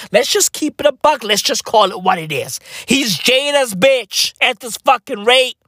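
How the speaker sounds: usual speaking voice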